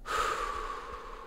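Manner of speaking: long soft unvoiced exhale; slow release sigh. Shown as a demonstration. Coach shows a calming breath while tapping her chest. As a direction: let the breath go slowly to calm down. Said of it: "Breathing a little bit slower".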